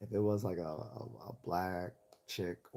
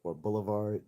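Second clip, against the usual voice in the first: lightly imitated Massachusetts "car" and "boulevard"; a touch of Massachusetts accent. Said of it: "say stuff like".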